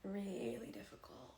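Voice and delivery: slow hushed unvoiced words; whisper. Real, not acted. Streamer whispering about her father's death. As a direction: let it fall to a whisper.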